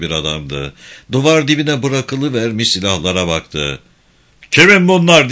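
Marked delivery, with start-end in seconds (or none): 4.5-5.3 loud, high commander's shout; raised voice demanding an answer; angry-officer shout. A narrator's reading. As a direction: shout the question at the soldiers. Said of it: "diye bağırdı".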